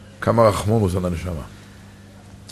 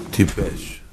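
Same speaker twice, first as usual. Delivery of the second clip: whisper; 3 semitones lower, 6 dB quieter, much slower than usual. Retold or imitated. Rebbe scolds him in a hush.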